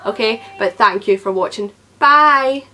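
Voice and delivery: ordinary talk, nothing marked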